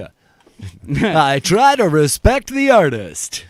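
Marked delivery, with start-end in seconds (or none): 1.3-3.4 loud, high, stretched-out announcer voice; show announcer. Pretend. Comics riff on a performer's stage name, hammed-up announcer. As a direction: ham it up like a club MC.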